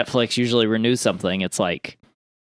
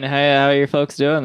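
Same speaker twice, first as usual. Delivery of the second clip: normal talking voice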